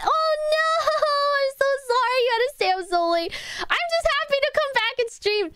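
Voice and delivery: high-pitched, fast and laughing; high squeal. Real, not acted. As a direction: squeal it apologetically. Squealing apology to chat.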